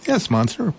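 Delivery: high, bright little mouse voice; eager little mouse. Acted.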